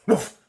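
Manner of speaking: loud dog bark; dog woof. A narrator's reading. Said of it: "said the dog".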